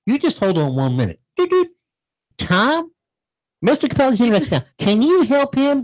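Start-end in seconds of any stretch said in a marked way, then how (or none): 1.3-1.7 mock phone beeps. Imitating phone hold tones. 2.4-5.8 high, bubbly phone agent calling to a colleague; sweet Southern agent. Retold or imitated. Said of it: "You sound like Tootsie".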